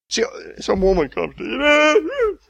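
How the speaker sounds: high, slowed mock voice of an upset woman breaking into a laughing cry; hammed-up whiny woman; preacher mimicking a woman who acts like a baby, high mock voice; mock emotional woman.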